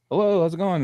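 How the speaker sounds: high, youthful phone greeting; imitating own high childhood voice; high-pitched boy voice. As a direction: answer as a boy with an unbroken voice. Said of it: "my fucking high-pitched voice".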